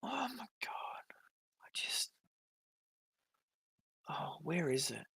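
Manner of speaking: whispered oh my God, stunned; barely voiced disbelief; appalled whisper. Real, not acted. Whispering in disbelief while scrolling her posts.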